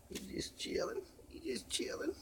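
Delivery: soft breathy whisper. Acted. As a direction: whisper it so the dog stays asleep. Repeats in fading takes.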